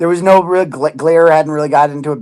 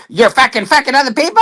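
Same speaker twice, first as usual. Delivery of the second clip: mock angry accusation; 8 semitones higher than usual. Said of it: "she's all mad at me".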